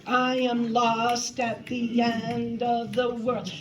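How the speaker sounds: dragged-out, slow lament; milk the line slowly, too much; overdone slow lost line. Shown as a demonstration. Choir director mocking an overdone line, slow and dragged. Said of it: "you shouldn't be".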